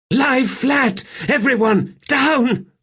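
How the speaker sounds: high, loud yell of warning; urgent shout. Acted. Seren warns the group to hit the ground, shouting. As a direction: scream at everyone to get down.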